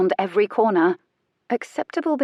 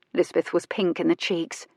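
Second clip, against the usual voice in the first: plain reading voice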